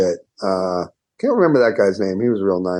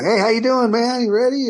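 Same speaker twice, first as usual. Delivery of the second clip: higher, upbeat hello; cheery greeting. Retold or imitated. Friend greeting him outside the house, higher cheery hello. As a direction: greet him up high and happy.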